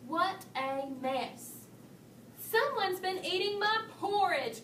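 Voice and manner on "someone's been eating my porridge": higher, lightly growled complaint; a touch of a cross papa bear; mock growl. A narrator's reading. Papa Bear finding his porridge eaten, mild mock growl. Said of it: "growled Papa Bear".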